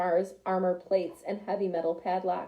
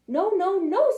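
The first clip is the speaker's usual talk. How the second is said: high, louder squeaky wolf cubs; little wolves refusing; 8 semitones higher, 5 dB louder than usual. Acted. Scared little wolves answering the pig, high and loud. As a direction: cry out no in little voices.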